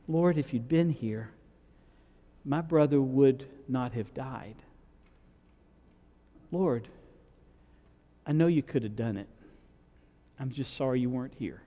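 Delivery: soft, broken-hearted plea at his feet; a quiet plea that stays loving and hopeful; kneeling, grieving plea. Retold or imitated. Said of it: "Can you imagine the difference in tone?".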